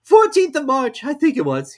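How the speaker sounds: high, loud apologetic voice; a nervous hatter excusing himself; Hatter's voice. A narrator's reading. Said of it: "he said".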